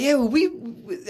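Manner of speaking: high flustered Elmo. Retold or imitated. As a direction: stammer it out as the puppet. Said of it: "he's like".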